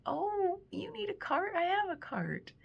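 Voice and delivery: sweet helper voice. Retold or imitated. Offering a stranger her cart at the store. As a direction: chirp it like a cheerful stranger offering help. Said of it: "under the guise of".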